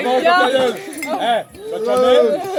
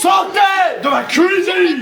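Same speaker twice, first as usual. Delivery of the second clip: shouting; 8 semitones higher, 5 dB louder, much slower than usual. Real, not acted. Chasing friends from kitchen.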